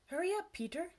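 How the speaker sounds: high, urging call; hurrying. A narrator's reading. New friend to Peter, hurrying him. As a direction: chivvy a friend along, pitched high.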